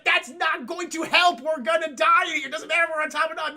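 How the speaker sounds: loud, high exasperated protest; panicked arguing. Retold or imitated. Leia to Han as walls close in, panicked yelling. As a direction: argue back at full panic.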